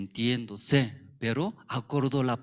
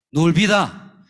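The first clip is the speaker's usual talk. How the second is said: loud warning; 5 semitones higher, 12 dB louder than usual. Acted. God warning Peter.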